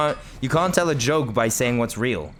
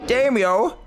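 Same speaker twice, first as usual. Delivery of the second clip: excited; 13 semitones higher, 4 dB louder than usual. Real, not acted. Excited exclamation at game.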